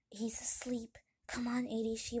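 hushed bedtime whisper; soft, secretive whispering. Acted. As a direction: whisper so the sleeper stays asleep. Children leave the sleeping dog.

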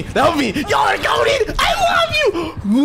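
high, squealing gratitude; overjoyed. Real, not acted. Player rescued by teammates, high ecstatic squeal. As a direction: shriek your thanks in relief.

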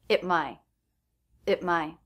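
"it my" with a schwa and a stopped T; reduced at, stop T. Shown as a demonstration. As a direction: cut off the T before "my". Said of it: "so the T here is a stop T".

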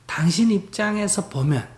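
ordinary talk

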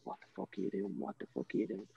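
low, very soft, near-whispered quote; hushed retold disbelief. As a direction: quote the friend under your breath, dropping into a near whisper. Friend's shocked words, retold hushed.

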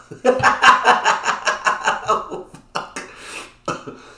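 high, loud airless wheezing laugh. Real, not acted. The vlogger after his Dalai Lama joke, long wheezing laugh. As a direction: laugh until no voice is left.

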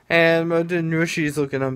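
ordinary talk, nothing marked

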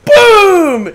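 high, loud, drawn-out boom; big explosive "boom"; imitated impact boom. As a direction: blast out a sound effect.

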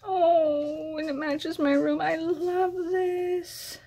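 high, delighted gushing; thrilled delight. Real, not acted. Seeing that the gift matches her room, thrilled. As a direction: gush high, drawing out the end.

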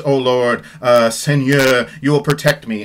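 grand theatrical recitation of the aria's words; dramatic reading. Shown as a demonstration. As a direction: read it like an operatic heroine in peril. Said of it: "Okay, big dramatic next section".

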